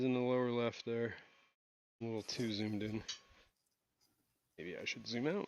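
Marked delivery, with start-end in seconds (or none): 2.0-5.5 stuffed-up nose, breathing heavily; blocked nose. Real, not acted. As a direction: talk through a blocked nose. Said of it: "why you're breathing h-, so hard".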